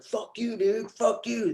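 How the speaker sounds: half-joking impression of a band cursing at fans; mock angry yell. Mocking bands yelling at crowd.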